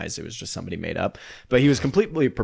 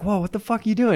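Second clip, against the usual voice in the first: high-pitched startled reaction; startled protest; 11 semitones higher, faster than usual. Retold or imitated. Dreamer protesting a friend's sudden punch, high and startled. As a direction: jump up in pitch, imitating shock.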